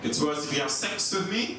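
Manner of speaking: ordinary talk, nothing marked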